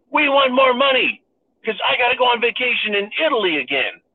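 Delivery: high, whining beggar voice; whiny mock Zelensky. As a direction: pitch it up and whine. Mocking Zelensky's demands.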